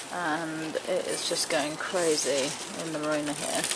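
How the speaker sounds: quiet worried update before sunrise; hushed early morning. Real, not acted. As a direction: keep it down, others asleep. 5am in marina, crew sleeping.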